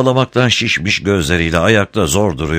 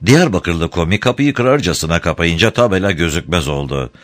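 usual speaking voice

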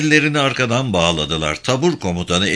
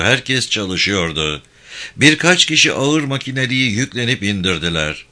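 ordinary delivery

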